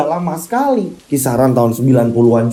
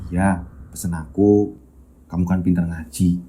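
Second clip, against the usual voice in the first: suara Bayu lirih; 10 semitones lower, 5 dB quieter than usual. Acted. Bayu's voice in the story. (suara anak lirih dan pelan, seperti berpamitan)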